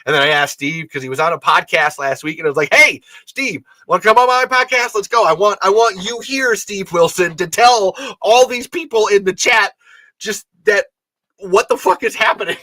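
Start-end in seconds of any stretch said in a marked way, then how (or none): 2.7-12.6 higher, louder, eager call to a friend; excited invite. Retold or imitated. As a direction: pitch it up and hype him in. Excited invite to Steve retold.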